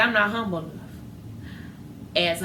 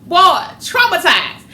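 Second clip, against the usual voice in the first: loud high dismay, slowed; horrified outcry; 9 semitones higher, 8 dB louder than usual. Acted. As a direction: cry out at the horror of it. Imagining no coming back.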